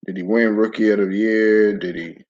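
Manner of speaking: normal talking voice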